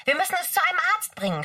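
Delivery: high and fast character voice. Acted. Kimchi urges the friends, high and fast. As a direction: rattle it off high and quick.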